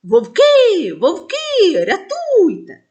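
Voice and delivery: loud, high false alarm cry for help. Acted. Shepherd boy tricking the shepherds, shouting loud and high. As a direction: shout for help, faking the panic. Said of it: "знову закричав".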